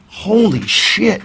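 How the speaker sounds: high, slow amazed exclamation; stunned holy shit; awed. Real, not acted. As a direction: breathe it out in wonder. Awed exclamation at life change.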